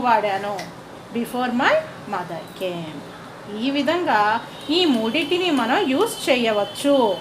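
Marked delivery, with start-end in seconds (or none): 1.1-3.6 very slow and softer; slow, soft dictation. Shown as a demonstration. Teacher finishing the model sentence for students, slow and soft. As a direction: trail the clause out gently.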